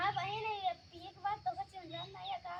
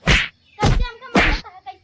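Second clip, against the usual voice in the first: anguished wailing; 5 semitones higher, 20 dB louder than usual. Acted. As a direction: cry out as if being hit. Wife crying out being beaten.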